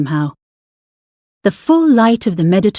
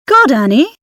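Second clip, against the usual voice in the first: higher and louder, a delighted outburst; loud and high, spotting an old friend; loud exclamation; 6 semitones higher than usual. Acted. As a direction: blurt it out in surprise. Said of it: "the woman said loudly".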